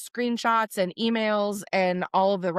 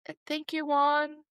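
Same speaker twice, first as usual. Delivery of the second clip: soft high gratitude; 7 semitones higher, 4 dB quieter than usual. Retold or imitated. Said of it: "I said".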